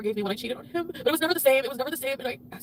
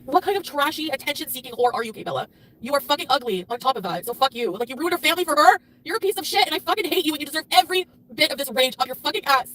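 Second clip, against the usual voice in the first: loud raging tirade at the other woman; furious rant; 4 dB louder than usual. Real, not acted. Betrayed wife raging at her husband's lover, furious. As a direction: spit it out full of hate. Raging at Kay Bella live.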